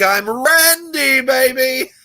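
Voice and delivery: milk it like a cheesy lounge entertainer; a stretched, high mock-swagger "I'm Randy, baby"; showman catchphrase. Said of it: "I go".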